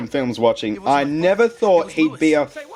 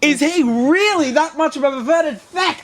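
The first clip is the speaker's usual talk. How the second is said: high-pitched outraged question; indignant cry, pitched way up; exasperated outburst; 11 semitones higher than usual. Acted. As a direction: blurt it out in high, comic disbelief. Outraged reaction to the character.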